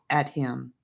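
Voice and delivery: usual speaking voice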